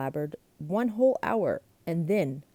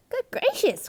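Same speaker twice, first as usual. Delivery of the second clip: high startled gasp; 6 dB louder than usual. A narrator's reading. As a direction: jolt up high in shock. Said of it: "gasped my sister".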